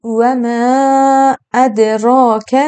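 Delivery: ما held long, very slow; very slow chanted و ما ادراک; two-beat madd. Shown as a demonstration. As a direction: stretch the vowel in ما for two counts. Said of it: "مد هم به اندازهٔ دو ثانیه باید بکشیم".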